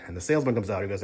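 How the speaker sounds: ordinary talk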